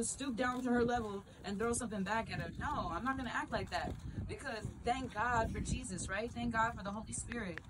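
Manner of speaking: ordinary delivery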